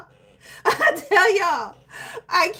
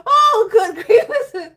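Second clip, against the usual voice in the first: laughing; 5 semitones higher, 5 dB louder than usual. Real, not acted. Laughing to tears.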